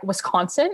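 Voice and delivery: "Wisconsin" as a rising question with "KAHN"; outsider pronunciation. Retold or imitated. Puzzled people questioning a local, "wis-KAHN-sin" as question. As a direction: ask "Wisconsin" with an open O sound. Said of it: "And people are like".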